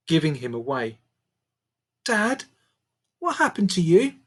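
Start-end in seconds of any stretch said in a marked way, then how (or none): 2.1-4.1 lightly voiced shocked girl. A narrator's reading. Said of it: "Lucy whispered in shock".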